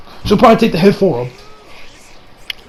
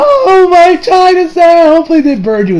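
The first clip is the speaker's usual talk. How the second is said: mock mother wail; 9 semitones higher, 4 dB louder than usual. Mother mourns her china set.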